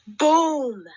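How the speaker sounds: loud explosive boom. A narrator's reading. The witch's tummy bursts, a loud boom.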